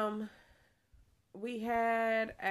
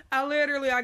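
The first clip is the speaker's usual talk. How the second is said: higher and louder, still laughing; 6 semitones higher, 8 dB louder, much faster than usual. Real, not acted. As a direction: speak through the laugh.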